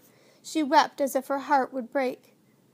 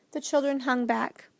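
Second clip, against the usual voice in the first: ordinary delivery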